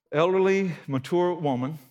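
normal talking voice

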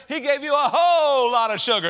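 loud, laughing punchline; laughing exclamation. Real, not acted. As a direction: shout it with a laugh.

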